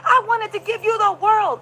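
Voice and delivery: high melodramatic wail; mock distraught reproach. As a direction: imitate a wronged lover pleading. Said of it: "He said".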